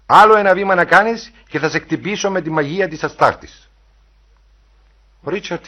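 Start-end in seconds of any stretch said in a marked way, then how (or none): 0.0-3.7 loud, high warning of a wrathful goddess; imperious, raised-voice menace; shouted threat. A narrator's reading. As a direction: threaten at full voice. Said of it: "φώναξε".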